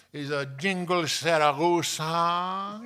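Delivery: loud, high, slow mock Spanish accent. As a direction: announce it with a drawn-out Spanish accent. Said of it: "She's talking like that".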